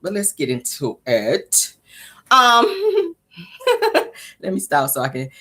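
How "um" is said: giggling she can't hold back; helpless little hiccupy laughs; hiccup giggles. Real, not acted. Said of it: "y'all stop making me laugh".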